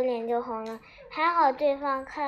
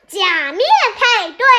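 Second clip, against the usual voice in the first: high, very loud squeal of delight; ear-splitting excited cry; excited shriek; 5 semitones higher, 9 dB louder than usual. A narrator's reading. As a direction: shriek it out, thrilled. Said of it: "潘多拉尖叫起来".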